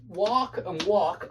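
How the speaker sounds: American "walk and walk", both with the same ah; American pronunciation. Shown as a demonstration. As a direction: identical American vowels in both words. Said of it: "are homophones only in America".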